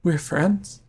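high, rising question; tentative surprise. Acted. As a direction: ask it in small-voiced disbelief. Revived rival asks in surprise.

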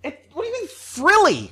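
indignant disbelief. Real, not acted. Indignant at shirt jab.

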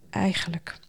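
soft, hushed, tentative start; softly. A narrator's reading. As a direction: let the word trail gently, unfinished. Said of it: "zei hij zacht".

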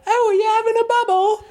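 chirpy hammed-up imitation of young Brits; mock British youth. Host imitates British twenty-somethings on the game show. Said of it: "they go".

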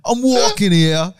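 put-on Brooklyn accent. Mocking the Brooklyn rendition, in a loud New York accent. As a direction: bellow it like a caricature Brooklyn local. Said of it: "all the fucking Brooklyn people out there".

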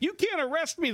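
mock losing it. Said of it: "she's just losing it".

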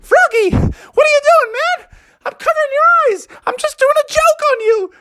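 very high squeaky cartoon mouse voice; giggly, playful mouse squeak; Mickey. Mickey teasing Froggy about his joke, high squeaky mouse.

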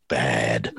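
"bad" drawn out long and low; emphasis on BAD. Shown as a demonstration. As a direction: lean heavily on the word "bad". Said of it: "I put the emphasis".

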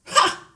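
a loud contemptuous "ha"; loud scornful snort. Acted. Headmistress snorting at the teacher, loud. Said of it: "snorted Miss Trunchbull".

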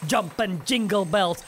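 ordinary talk

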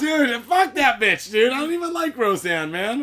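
indignant protest. Real, not acted. Friend just scared by Roseanne picture, indignant protest. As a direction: protest loudly after a nasty fright.

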